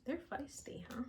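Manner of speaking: breathy whisper to herself; softly whispered remark; whispered aside. Real, not acted. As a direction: whisper it like a private joke. Aside about the feisty cards.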